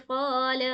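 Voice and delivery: قال with a slow, stretched first vowel; teacher's demonstration, lengthening "aa" in قال; drawn-out vowel. Shown as a demonstration. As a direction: hold the "aa" of قال long. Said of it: "یہاں کھینچ رہے ہیں ہم الف مدہ".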